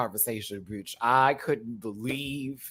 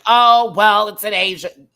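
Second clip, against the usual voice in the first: louder, higher mock dismissive voice; 9 semitones higher, 13 dB louder than usual. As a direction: brush it off carelessly, imitating. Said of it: "like someone going".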